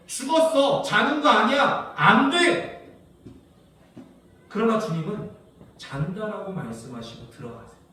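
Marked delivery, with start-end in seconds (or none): 0.1-3.0 higher, louder scornful verdict, last words dragged slow; scoff, no way she sleeps; mocking. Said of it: "내가 봤더니 뭐예요?". (비웃으며 높고 크게 단정하듯)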